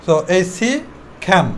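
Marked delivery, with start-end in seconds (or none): 1.2-1.5 খ্যান with a puff of air after the K; aspirated K. Shown as a demonstration. Teacher introduces the new C sound, aspirated K. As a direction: release breath on the K of খ্যান. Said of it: "এটাকে খ উচ্চারণ করব".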